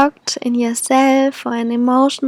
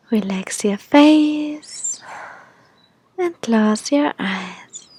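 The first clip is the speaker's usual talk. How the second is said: airy breathy mystical healer. Acted. Leads a guided meditation.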